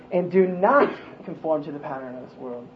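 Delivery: "not" punched hard, the rest of the verse easing off; stress on "not". Shown as a demonstration. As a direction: land firmly on the word "not". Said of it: "make an emphasis on each word".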